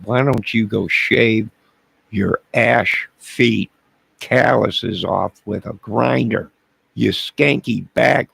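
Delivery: comic bad boy rant at Debbie; hammed-up insult. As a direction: spit each insult out slowly and loud.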